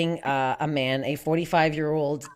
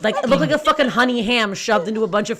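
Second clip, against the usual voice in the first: normal talking voice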